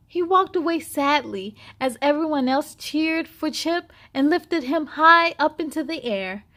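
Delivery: ordinary delivery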